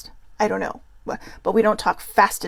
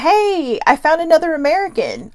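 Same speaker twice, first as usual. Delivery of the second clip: higher and louder, calling across a field; 8 semitones higher, 6 dB louder than usual. Retold or imitated. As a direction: call her over, pleased with the find. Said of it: "He's like".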